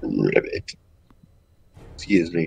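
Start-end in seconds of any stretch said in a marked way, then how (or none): 0.0-0.7 deep froggy ribbit; low croak. Real, not acted. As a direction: croak it like a frog. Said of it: "so I said ribbit".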